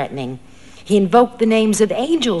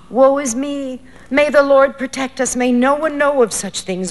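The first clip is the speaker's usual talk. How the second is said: frightened cry; 7 semitones higher, 3 dB louder than usual. Acted. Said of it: "a woman cried out".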